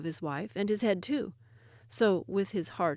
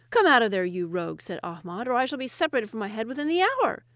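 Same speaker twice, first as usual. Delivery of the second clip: loud, urgent scolding; scolding Ahmad; 3 semitones higher, 6 dB louder than usual. Acted. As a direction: order the rogue out, alarmed.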